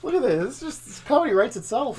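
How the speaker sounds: high, loud amused outburst; delighted laughing exclamation; laughing excitement. Real, not acted.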